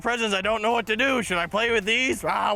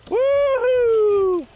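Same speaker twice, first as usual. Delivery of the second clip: much higher, loud whoop of joy; excited whoop; 7 dB louder than usual. Acted. Pirate finding more presents, loud joyful whoop. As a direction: cheer like you just won.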